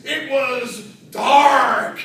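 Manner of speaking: drawn-out, ominous "dark"; dramatic slow pause. Acted. Speaker spinning a spooky tale, dwelling slowly on dark. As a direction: let "dark" hang, slow and heavy.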